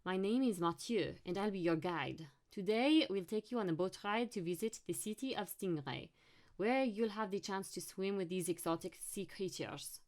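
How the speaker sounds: put-on thick French accent; heavy French-accented welcome speech; Mathieu. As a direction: play a French tour guide addressing the group. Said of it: "he said in a thick French accent".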